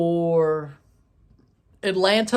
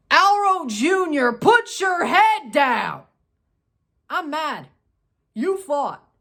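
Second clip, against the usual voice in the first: scolding; 6 semitones higher, slower than usual. Real, not acted. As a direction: call him out by name and drag the order. Scolding a player for fighting.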